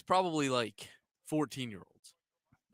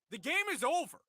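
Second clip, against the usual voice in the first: umpire's call; 13 semitones higher than usual. Retold or imitated. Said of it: "He goes".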